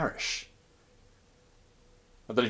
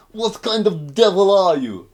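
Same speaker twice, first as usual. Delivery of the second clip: high, loud tipsy Russian coaxing; drunk mock Russian; 10 semitones higher, 12 dB louder, slower than usual. Drunk Krichkov urging the Wishmaster to drink. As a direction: wheedle him like a drunk pal.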